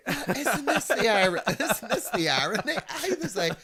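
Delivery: high, loud laughing delivery. Real, not acted. Guest laughing at the irony of mum's story. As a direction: crack up at the irony.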